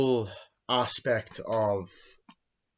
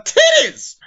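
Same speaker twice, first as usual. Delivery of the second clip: sudden loud, high outburst; high whooping yell on the punchline; gleeful shout; 16 dB louder than usual. Real, not acted. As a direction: blurt it out at the top of your voice.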